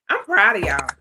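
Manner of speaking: ordinary talk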